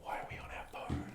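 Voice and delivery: whispered aside, barely voiced. Real, not acted. Teasing co-hosts for checking their phones, whispered. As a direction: whisper it like a sly jab.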